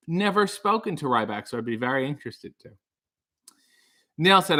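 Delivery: ordinary talk, nothing marked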